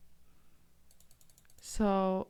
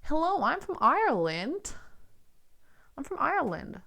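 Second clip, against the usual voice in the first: higher, playful Irish accent practice; trying an Irish accent; 6 semitones higher than usual. Shown as a demonstration. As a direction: greet them as an Irish local, higher. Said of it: "And say Ireland instead of land".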